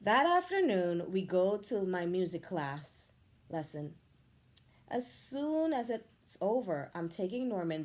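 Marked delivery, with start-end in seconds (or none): none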